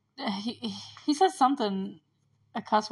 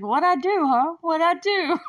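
clueless dad; 8 semitones higher, 5 dB louder than usual. Retold or imitated. Oblivious dad protesting.

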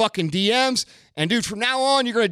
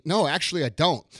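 ordinary talk, nothing marked

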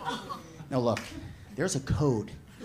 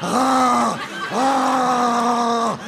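long, strained strangled cries; imitated choking noises; 4 semitones higher than usual. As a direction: gag like a movie strangling victim. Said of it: "making noises like Luca Brasi".

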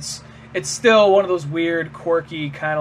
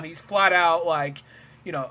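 usual speaking voice

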